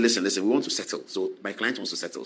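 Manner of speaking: quick, low, hushed aside; hushed deal-making. Retold or imitated. Lawyer hushing opposing counsel, a quick quiet settling. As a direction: rush it low like a private deal.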